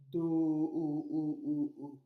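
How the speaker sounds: "do" puis le "o" répété en écho; son O isolé. Shown as a demonstration. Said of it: "et le son". (le son « o » prolongé et répété)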